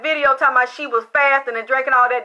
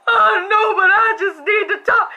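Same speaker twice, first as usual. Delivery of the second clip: louder, higher, whimpering plea; mock crying plea; 8 semitones higher, 3 dB louder than usual. Dead friend begging the teller at night, mock crying plea. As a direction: sob it out like a begging ghost. Ghost Lisa pleading again.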